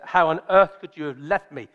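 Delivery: ordinary talk, nothing marked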